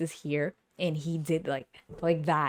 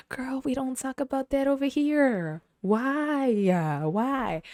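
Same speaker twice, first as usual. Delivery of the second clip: mock dramatic protest; 8 semitones higher, 4 dB louder, slower than usual. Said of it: "I was like".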